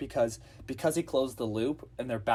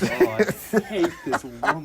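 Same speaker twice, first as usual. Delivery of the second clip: laughing disgust; 5 semitones higher, 7 dB louder, slower than usual. Real, not acted. Annoyed by the teacher.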